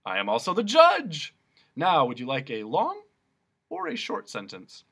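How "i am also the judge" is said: booming and high, full of self-importance; pompous proclamation. Acted. Policeman announces to Milo he is judge, booming.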